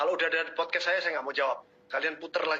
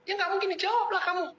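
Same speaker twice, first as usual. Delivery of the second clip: exasperated mock outburst; 13 semitones higher, faster than usual. Real, not acted. Mocking a hopeless DM attempt.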